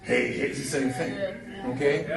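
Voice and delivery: put-on Fat Albert greeting; lightly voiced cartoon Fat Albert; a hint of Fat Albert's hey hey. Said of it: "Fat Albert sounds the way it does".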